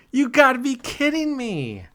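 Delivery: high-pitched exasperated disbelief; incredulous protest. Real, not acted. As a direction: wail it at your rotten luck. Bad luck strikes again.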